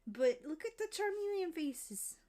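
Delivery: delighted pointing out. Real, not acted. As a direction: pitch up with delight at the faces. Showing off cute drawings again.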